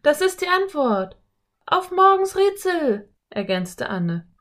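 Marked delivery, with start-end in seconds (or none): 0.0-1.2 high, loud burst of discovery; sudden excited cry. A narrator's reading. Philipp solves the riddle. 1.6-3.1 put-on high, loud girlish cheer; excited girl. A narrator's reading. Anne completes the discovery.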